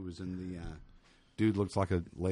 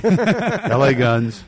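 drawn-out announcement; 4 semitones higher, 11 dB louder, much slower than usual. Acted. Drawn-out band name.

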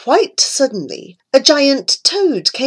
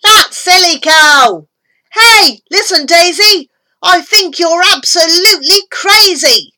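loud jeering yell, higher; yelled aloud; 6 semitones higher, 6 dB louder than usual. Acted. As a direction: bellow the taunt from the crowd. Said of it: "raised up his voice and yelled aloud".

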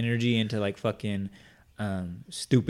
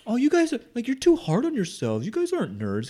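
much higher, gushing consoling tone; high mock sympathetic voice; 13 semitones higher than usual. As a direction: coo at them like a kind friend. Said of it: "they were just like".

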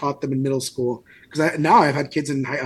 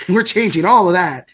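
gleeful, louder mock taunt ending in a laugh; 7 semitones higher, 5 dB louder than usual. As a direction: gloat like a smug textbook. Mocking inorganic chem personified.

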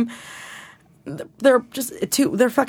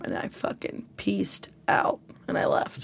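whisper; 3 semitones lower, 10 dB quieter than usual. Real, not acted. Whispered end of the story.

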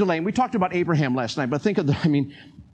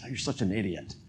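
low, soft self-scolding mutter; 6 semitones lower, 7 dB quieter than usual. Retold or imitated. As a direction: scold yourself under your breath. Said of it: "I just said something so silly".

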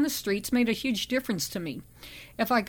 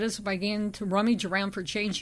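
ordinary delivery, nothing marked

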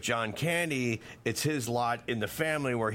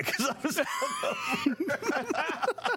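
laughing through the story, ending in a laughing shout much higher; much slower than usual. Real, not acted. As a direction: tell it while cracking up. Laughing through hungover Armageddon story.

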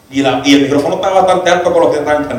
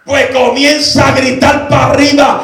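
raised, driving Pentecostal preaching voice; full-force preaching; 10 semitones higher, slower than usual. Acted. Preacher calling the church to praise, preaching at full force. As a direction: build to a drawn-out shouted call.

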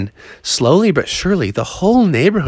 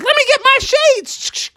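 very high, slow falsetto mom; excited mom; slower than usual. Acted. Froggy's mom joins him outside, excited falsetto. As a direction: squeal it with glee in falsetto. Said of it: "She's like".

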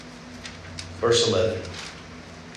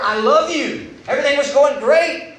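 higher, earnest and longing; longing God; 8 semitones higher than usual. Retold or imitated. God longing for Adam and Eve, higher and pleading. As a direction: plead like a heartbroken friend. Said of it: "It's more the longing of his heart".

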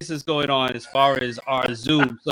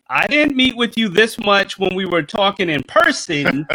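exasperated quote; 7 semitones higher than usual. Retold or imitated. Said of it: "It's like".

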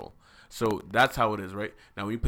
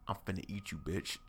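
much softer villain threat; mock menace; 3 semitones lower, 13 dB quieter than usual. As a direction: quietly play a hungry monster. Champion threatens the mage.

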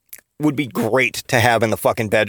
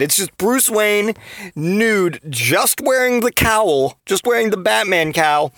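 ordinary delivery

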